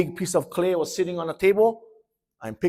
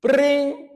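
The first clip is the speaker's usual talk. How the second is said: bell ring; 10 semitones higher, 4 dB louder than usual. Retold or imitated. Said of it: "The bell went ring".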